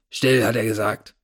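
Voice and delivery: a touch of a rough thug voice; dark rough voice. A narrator's reading. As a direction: growl the order from behind. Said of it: "eine dunkle, raue Stimme hinter mir".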